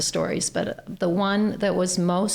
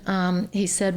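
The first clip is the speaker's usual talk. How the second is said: ordinary delivery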